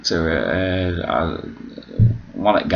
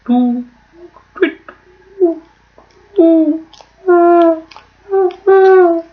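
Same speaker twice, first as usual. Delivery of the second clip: high, rising hooting like an owl; mock owl hoots climbing in pitch; 5 dB louder, slower than usual. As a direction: hoot like a magical owl, louder each time. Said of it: "It'd be like".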